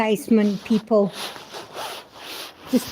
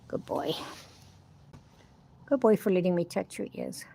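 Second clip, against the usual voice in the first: tender; 2 semitones lower, 9 dB quieter than usual. Real, not acted. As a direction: soothe a nervous horse gently. Soothing horse after touching ears.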